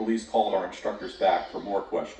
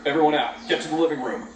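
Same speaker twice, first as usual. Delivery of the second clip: loud sharp command; barked order; 9 semitones higher, 6 dB louder, much faster than usual. Acted. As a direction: bark it at the students. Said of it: "Mister Jenkins barked".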